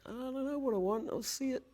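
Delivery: high, light self-mocking voice; clueless director. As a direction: act out not knowing what you want. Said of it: "I'm like".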